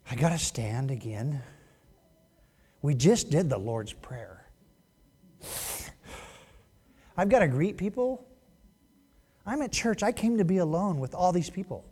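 softly grumbling churchgoer, long pauses; poor attitude. Acted. Preacher mimics reluctant churchgoer's complaints, mildly grudging. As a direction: lightly act a put-upon complainer. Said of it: "is their poor attitudes".

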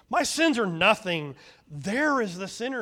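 loud, high scoffing retort; scornful dismissal. A narrator's reading. Fault-finding son scoffing at firstborn and pointing to hut, loud. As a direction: wave off his own sins. Smirking son deflects blame.

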